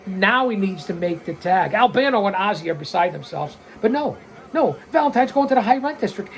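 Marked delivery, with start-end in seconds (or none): none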